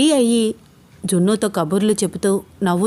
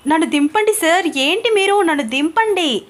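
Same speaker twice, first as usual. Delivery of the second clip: much higher, louder squirming protest; flustered protest; 9 semitones higher, 3 dB louder than usual. Acted. Ananya flustered at being carried, squirming protest. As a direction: wriggle and beg to be put down. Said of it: "అని గింజుకుంటూ".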